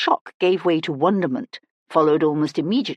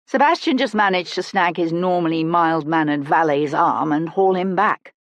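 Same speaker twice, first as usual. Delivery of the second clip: ordinary delivery, nothing marked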